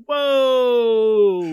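loud, high mock startle; startled whoa. Megamind faced with the villain, loud high startled gasp. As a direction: act out being caught off guard. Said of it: "Megamind's like".

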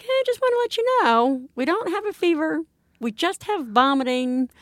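high, sugary polite mock-up of herself; overly sweet caller. Mother retells calling on-call doctor, mock sugary politeness. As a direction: sound extra nice to the on-call doctor.

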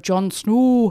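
Northern English "Snow" with a rounded, drawn-out vowel; Jon Snow accent. Shown as a demonstration. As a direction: put on a Game of Thrones Northern accent. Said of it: "doing what many people identify as".

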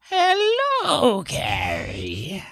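high, eerie talking kitten voice; creepy cat voice. Acted. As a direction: speak as a spooky little kitten. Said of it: "That's a pretty good cat voice".

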